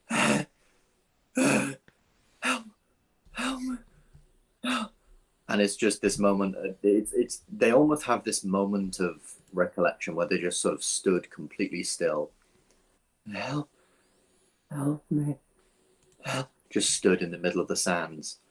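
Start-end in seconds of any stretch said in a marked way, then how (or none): 0.1-4.9 high, faint, trailing plea; dazed pleading. Acted. Dazed pixie repeating plea. 13.3-16.5 high, faint, trailing plea; dazed pleading. Acted. Dazed pixie repeating plea.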